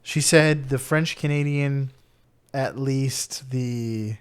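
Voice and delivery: usual speaking voice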